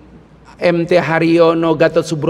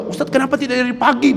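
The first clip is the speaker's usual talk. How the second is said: fretting committee man; 7 semitones higher, faster than usual. Retold or imitated. Mosque committee complaining quote.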